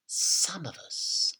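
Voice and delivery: grandma whispering to George; sinister whisper. A narrator's reading. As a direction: lure the boy in, hushed.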